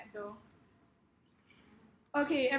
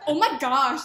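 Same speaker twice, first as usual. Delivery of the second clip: louder American bimbo exclamation; American accent; 10 dB louder, slower than usual. Shown as a demonstration. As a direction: gush it in a put-on American accent. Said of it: "in American accent".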